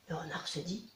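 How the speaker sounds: ordinary delivery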